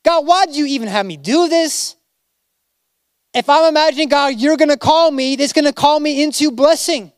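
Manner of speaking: voiced frustration aimed at God; frustrated questioning. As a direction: complain like someone who feels let down. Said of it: "Anyone ever asked that".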